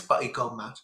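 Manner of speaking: ordinary talk, nothing marked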